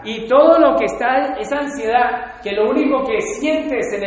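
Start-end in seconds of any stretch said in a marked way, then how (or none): none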